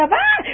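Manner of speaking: sudden squeaky cry of alarm; high panicked yelp. Retold or imitated. Girl running home yelps at her mismatched shoes, high panicked cry. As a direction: yelp way up high, breath cut short.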